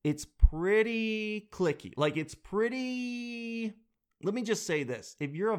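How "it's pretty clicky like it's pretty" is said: drawn-out words. Shown as a demonstration. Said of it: "I will say this about blending".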